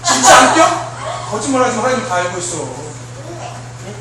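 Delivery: ordinary talk, nothing marked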